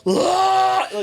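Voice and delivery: high loud imitated wail; wife losing it in the kitchen; mock wail. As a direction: let out a hammed-up frustrated scream. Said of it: "losing it".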